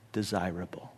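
breathy whisper on desirable; hushed, tender whisper. Acted. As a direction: drop the word to a whisper. Pastor on wives' self-image.

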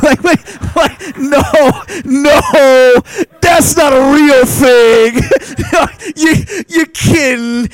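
high, loud laughing protest; half-crying with laughter at the absurdity; laughing disbelief. Retold or imitated. Pastor telling church about awake surgery, laughing high disbelief.

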